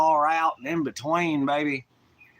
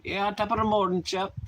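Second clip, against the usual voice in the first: higher-pitched mock Irish accent; put-on Irish accent; 6 semitones higher than usual. Streamer playing at the Irish thing, mock Irish accent. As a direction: toss off a stock Irish greeting in accent. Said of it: "the whole Irish thing".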